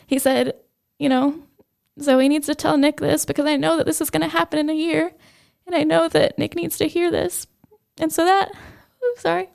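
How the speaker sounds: high, tight voice on the verge of crying; holding back tears. Real, not acted. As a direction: push on through real welling tears. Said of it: "trying really hard not to cry".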